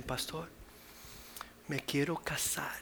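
whispered, slow, shy request; shy young girl. Retold or imitated. Young girl telling the pastor she wants to marry, shy whisper. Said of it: "una jovencita".

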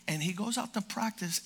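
ordinary talk, nothing marked

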